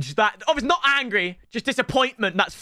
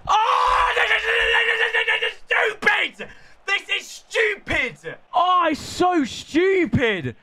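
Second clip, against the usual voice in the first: frustrated yelling; 13 semitones higher, slower than usual. Real, not acted. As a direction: yell in disbelief at the game. Frustrated gamer yelling at match.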